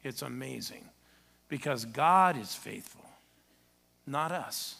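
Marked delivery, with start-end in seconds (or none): 1.5-3.0 "Because God is faithful" slow and louder; slow emphasis. Real, not acted. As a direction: lean on "God is faithful" slowly. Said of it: "This is it".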